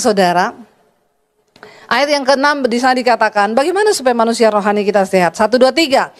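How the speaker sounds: ordinary delivery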